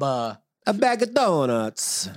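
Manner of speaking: high, slow Italian sing-out; mock Italian accent. Shown as a demonstration. Showing friends Italian, high drawn-out accent. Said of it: "Italian, you stress the".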